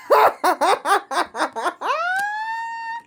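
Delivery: long laugh climbing to a squeal; real high laughing fit; helpless cackle. Real, not acted. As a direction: crack up at your own remark. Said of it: "laughing in tears".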